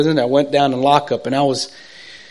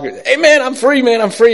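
higher, louder, bursting with joy; crying out in joy; 9 semitones higher, 5 dB louder than usual. Retold or imitated. Man phoning friends at midnight, overjoyed and tearful. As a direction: blurt out the news, nearly in tears. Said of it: "And I was crying".

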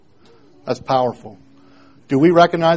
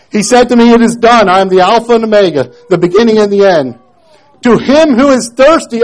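loud, higher reading of scripture; preached scripture reading; 6 semitones higher, 8 dB louder than usual. Acted. Preacher reads the verses to the congregation, loud. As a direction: proclaim the verses with force.

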